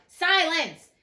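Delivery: loud, high shout of Silence!; cackled command. A narrator's reading. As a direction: bark it out to silence the court. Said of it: "cackled out".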